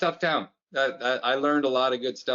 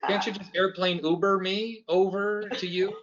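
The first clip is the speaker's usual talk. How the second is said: playful exaggeration; 9 semitones higher than usual. Joking complaint about air bases.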